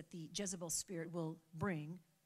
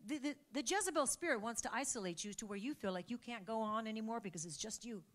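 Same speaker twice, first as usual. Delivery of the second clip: ordinary talk, nothing marked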